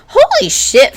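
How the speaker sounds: high squealed curse; shocked squeal. Real, not acted. Vlogger squealing at the sight of an old fan fiction site. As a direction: squeal it in disbelief.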